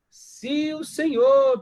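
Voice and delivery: loud, high, very drawn-out request; hammed-up polite entreaty; acted plea. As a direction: stretch it out high like a petition to a king. Acts Nehemiah petitioning the king.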